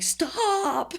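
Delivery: high, strained mock plea through a tube; with a tube in the mouth. Waking patient pleading with her boyfriend, high and strained. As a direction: squeak it out past a breathing tube. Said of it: "with the tube in my mouth".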